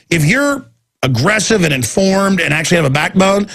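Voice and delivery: ordinary talk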